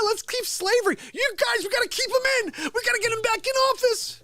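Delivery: high mocking falsetto; mocking voter voice. Mocking Democrat voters in a high squeaky voice.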